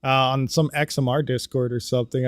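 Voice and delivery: normal talking voice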